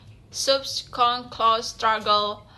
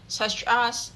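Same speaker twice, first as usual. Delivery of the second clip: normal talking voice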